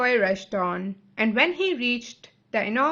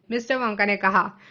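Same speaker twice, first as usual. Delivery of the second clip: ordinary delivery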